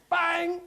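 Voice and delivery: high, punchy "bang"; imitated bat strike. Horse hits the balls in the nets, punchy bang. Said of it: "the horse went".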